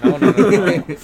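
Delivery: loud high laughing no's. Real, not acted. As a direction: laugh through the words. Laughing denial.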